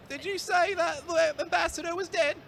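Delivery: high, put-on orphan voice in a bad accent; Victorian orphan. Groundskeeper stammering to the warden in a high orphan voice. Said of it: "really bad accent".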